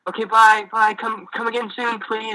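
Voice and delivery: hopeful dad goodbye. Retold or imitated. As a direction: call after them, hoping they return.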